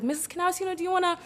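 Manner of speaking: mildly acted plea for rescue; imitating choked-up appeal. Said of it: "choked up".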